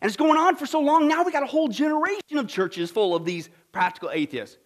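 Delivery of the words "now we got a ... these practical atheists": usual speaking voice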